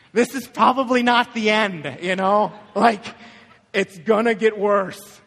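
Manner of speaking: loud, comic reality check; hammed-up loud, emphatic delivery; mock blunt warning. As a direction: play a dad loudly breaking bad news for laughs. Joking blunt reply to sick son.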